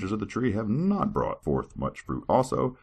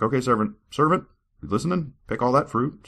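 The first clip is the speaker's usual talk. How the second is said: higher, bossy master voice; bossy God; 7 semitones higher than usual. Acted. God bossing his servant about the fruit, higher. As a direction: order the servant around. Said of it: "He's like".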